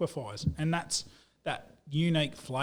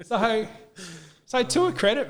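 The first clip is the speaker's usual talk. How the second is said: laughing, louder and higher; 8 semitones higher, 6 dB louder than usual. Real, not acted. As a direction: still chuckling as he carries on. Laughing after the punchline.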